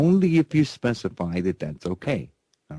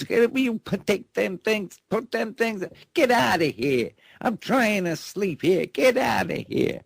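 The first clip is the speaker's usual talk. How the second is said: high-pitched shouting at the tree crew; mock yell; 13 semitones higher than usual. Sleepy homeowner yells at the tree crew, high and shouting. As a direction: yell them off the lawn, voice cranked way up. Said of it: "he yells at these guys".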